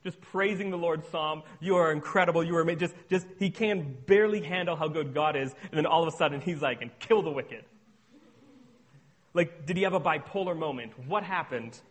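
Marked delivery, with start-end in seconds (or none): 1.6-2.8 loud, gushing praise; overflowing with awe, words failing; exclamatory praise. Retold or imitated. Preacher voicing the psalmist overwhelmed by God, gushing. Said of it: "the most exclamatory praise". 6.8-7.7 abrupt snap. Retold or imitated. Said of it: "He snapped".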